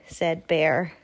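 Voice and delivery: ordinary delivery, nothing marked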